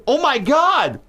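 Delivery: mock shocked cry in the actor's accent; Mark Wahlberg accent. Cop realizes he shot the player, Wahlberg accent imitation. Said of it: "You know, Mark Wahlberg's accent".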